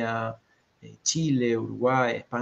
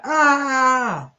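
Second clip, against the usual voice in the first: long, high, loud scream; 13 semitones higher, 7 dB louder than usual. A narrator's reading. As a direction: scream out on one sustained high note. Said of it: "Y Olivia grita".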